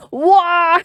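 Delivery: high, hammed-up British "waw"; British accent. Streamer amused by British war, high mock British accent. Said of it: "how do the British say war".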